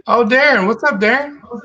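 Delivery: normal talking voice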